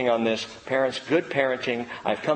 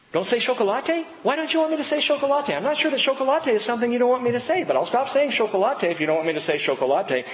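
mock innocent teasing; 8 semitones higher, faster than usual. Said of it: "And I go".

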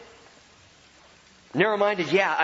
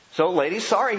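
ordinary delivery

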